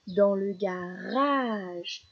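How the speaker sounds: "garage" slow, long held "rage"; last syllable drawn out. Shown as a demonstration. Teacher pointing out the repeated syllable, "garage" drawn out. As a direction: stretch the end of "garage". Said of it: "les dernières syllabes qui sont répétées".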